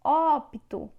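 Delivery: teacher repeating the word emphatically; stress on op. Shown as a demonstration. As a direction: bang out the Óp in Ópto. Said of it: "A sílaba tônica é o op".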